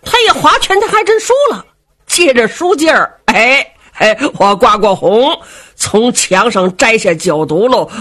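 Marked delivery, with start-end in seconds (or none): none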